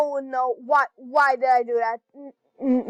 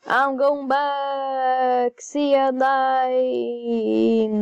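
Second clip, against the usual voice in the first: slow teasing goodbye; 3 semitones higher, much slower than usual. Real, not acted. Leaving the fight.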